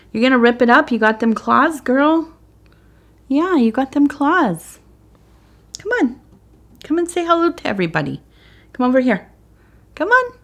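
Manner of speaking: high, sing-song pet voice. Real, not acted. Baby talk to her cat.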